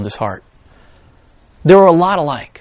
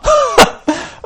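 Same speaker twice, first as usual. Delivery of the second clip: laugh. Real, not acted. Laughs at own cringe.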